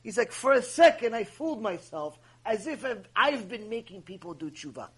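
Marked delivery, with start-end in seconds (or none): none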